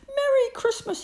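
high, loud shout of joy. Acted. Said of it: "he shouted".

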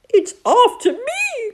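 high, loud frightened cry. Acted. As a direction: cry out, hopping in panic. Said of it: "cried Toad".

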